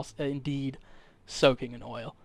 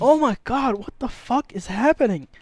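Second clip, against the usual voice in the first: high, loud, alarmed outburst; panicking shopkeeper; 7 semitones higher, 4 dB louder than usual. Acted. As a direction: cry out in bewildered panic. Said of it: "the people are panicking".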